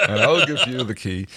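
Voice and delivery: laughs it out high in a put-on voice; giggling through a mock farmer line; laughing along. Joins the Bristol farmer song.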